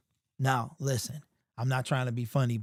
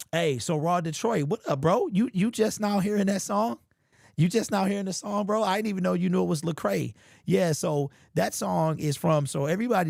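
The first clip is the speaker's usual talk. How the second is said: excited; 7 semitones higher, 3 dB louder than usual. Real, not acted. Excited reply to chat.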